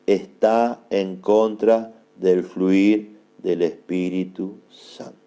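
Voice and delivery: low, slow, word by word with pauses; said very slowly. Shown as a demonstration. Preacher gives note-takers the second point, very slowly. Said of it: "Lo digo despacito".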